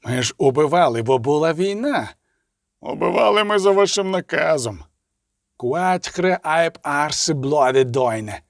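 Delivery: indignant outcry ending in a stretched curse; angry protest. A narrator's reading. Elf officer blames the orders he followed, angry protest. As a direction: protest hotly, then drag out the foreign curse. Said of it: "крикнув Ангус Брікрі".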